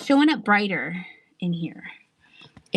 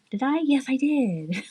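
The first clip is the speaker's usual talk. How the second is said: playful put-on voice; 3 semitones higher than usual. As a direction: stretch it out in a goofy put-on voice. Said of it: "why I'm talking like that".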